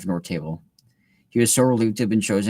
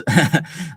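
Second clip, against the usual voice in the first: laugh; 7 semitones higher, 4 dB louder than usual. Real, not acted. Laughing at own joke.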